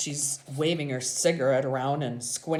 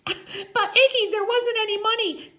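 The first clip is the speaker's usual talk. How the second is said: very high, loud woman's voice; high-pitched whiny objection; Loretta, protesting; 8 dB louder than usual. A narrator's reading. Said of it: "Loretta coughs on her new cigarette".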